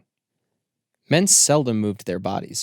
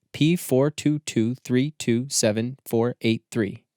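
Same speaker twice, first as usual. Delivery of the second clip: slow, measured number read; slow code reading; slower than usual. Acted. The machine's book recites its reference slowly.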